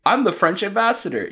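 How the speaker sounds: high, mocking quote; mock announcement. Quoting the ambassador mockingly.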